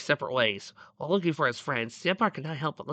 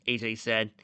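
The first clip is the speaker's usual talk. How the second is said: usual speaking voice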